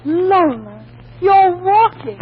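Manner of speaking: high, loud cry of amazed delight; excited cry. Acted. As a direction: cheer her on, thrilled she is walking. Sister sees her walk.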